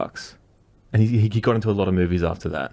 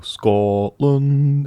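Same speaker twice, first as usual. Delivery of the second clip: rolled, drawn-out Scottish Scotland; mock Scottish accent; 4 semitones higher than usual. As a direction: say it like a proud Scot, lightly. Mock Scottish word joke.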